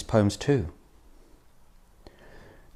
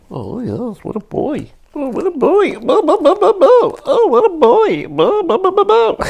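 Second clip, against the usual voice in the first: very high, squeaky pet-talk building to loud; gushing dog-talk; 10 dB louder than usual. Pretend. Owner fussing over his good boy, gushing dog-talk. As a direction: gush in a silly high falsetto.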